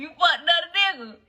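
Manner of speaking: can't believe what he heard; stunned disbelief. Retold or imitated. Boyfriend hearing she cheated, stunned disbelief.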